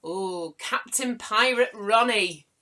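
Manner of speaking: loud, drawn-out mock Cornish pirate; Cornish-tinged pirate accent. Host hails a viewer's pirate name, playful Cornish pirate accent. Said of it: "Cornish when I do my accent".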